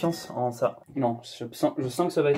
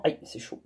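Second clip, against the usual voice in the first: petit cri étouffé, ça brûle; aïe chuchoté de douleur; 7 semitones lower, 8 dB quieter than usual. Real, not acted. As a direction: souffler sa douleur sans voix. Se brûle en filtrant le sirop. (aïe étouffé, ça brûle)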